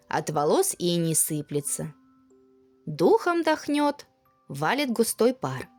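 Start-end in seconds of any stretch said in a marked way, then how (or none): none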